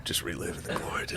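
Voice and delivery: breathy mock whisper. Friends joking, whispering as a washed-up regular. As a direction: whisper it like a sad old regular.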